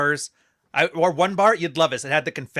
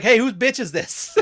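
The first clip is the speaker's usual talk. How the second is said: mock tough guy; 8 semitones higher, slower than usual. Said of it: "And he's like".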